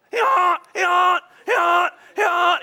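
loud, very high imitated donkey hee-haw; panicked donkey bray. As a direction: bray frantically like a trapped donkey. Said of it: "He's freaking out".

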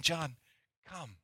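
whisper-soft welcome; tender call. Acted.

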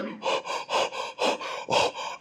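repeated airy gasps, as if mouth on fire; rapid mock-panicked puffs of breath; panting gasps. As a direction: mimic gasping from burning spicy food. Mimes mouth burning from wasabi.

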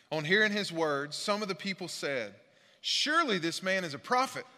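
lifted, declaiming read of the passage; scripture reading. Acted. Pastor reads John aloud to the church, declaiming. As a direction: read the verses out to the congregation.

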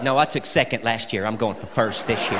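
mock deadpan mom. Comic imitating his mother's deadpan reply to his complaint, low and soft. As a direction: answer flat and proud. Mom's comeback.

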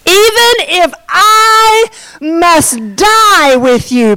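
loud, drawn-out emphatic declaration; Peter's bold vow. Acted. Peter vowing loyalty to Jesus, bold and emphatic. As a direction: proclaim it high and very slowly. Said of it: "What does Peter say?".

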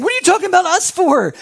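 the patriarchs protest at once; startled protest. Retold or imitated. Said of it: "And they're over here saying".

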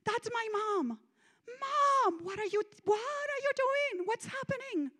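high, pleading cry; frantic high-pitched appeal; desperate. Retold or imitated. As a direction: call out in rising panic for mom. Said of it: "I remember feeling desperation".

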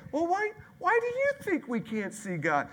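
very high, coaxing dad voice; hammed-up patient father; sing-song parent voice. Shown as a demonstration. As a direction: demo a sweet questioning parent. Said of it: "this is the best way to do it".

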